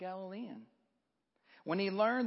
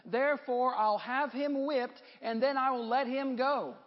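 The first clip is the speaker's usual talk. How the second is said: a touch of a ruler declaring his verdict, pitched up; lightly acted, higher-pitched public declaration; Pilate addressing crowd; 6 semitones higher, 4 dB louder than usual. A narrator's reading. Pilate defending Jesus before priests and people, emphatic declaration.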